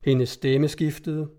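ordinary delivery, nothing marked

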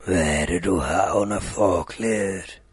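deep booming big bad wolf. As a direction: growl it out low like a big wolf. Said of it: "the wolf questions Rødhætte".

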